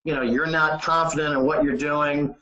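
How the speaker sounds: normal talking voice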